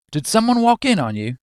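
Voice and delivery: high-pitched, badly faked Australian accent; attempted Australian. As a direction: have a shaky go at an Australian accent. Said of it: "I can't do Australian".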